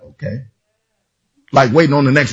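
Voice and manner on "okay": much lower, much softer flat okay; bored congregation reply; unimpressed okay. Retold or imitated. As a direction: shrug it off, unimpressed. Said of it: "And you're like".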